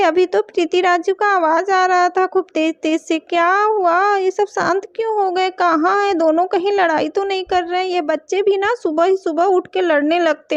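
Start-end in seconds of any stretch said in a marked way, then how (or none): none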